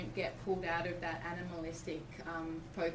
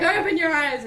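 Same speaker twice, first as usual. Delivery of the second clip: higher, louder, firm repeat of "Open your eyes."; insistent command; 9 semitones higher, 14 dB louder than usual. Retold or imitated. Midwife insisting again.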